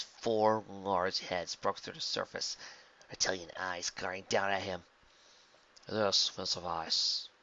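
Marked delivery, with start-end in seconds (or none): 5.9-7.3 cold calculating schemer; scheming villain. A narrator's reading. Villain Vildrax to the hydra.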